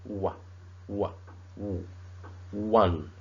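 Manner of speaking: wa, wa, w, then "one": the word built from its w sound; slow sound-by-sound build. Shown as a demonstration. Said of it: "pronounced as wa".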